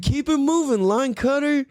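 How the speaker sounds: high, mild mock yell; retold yell. Driver heckling the line cutter ahead, retold yell. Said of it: "what I yelled at her".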